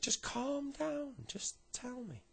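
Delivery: very high, drawn-out coaxing voice; coaxing calm-down. Acted. Mum urges son to tell her, high coaxing. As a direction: stretch it out high to settle him.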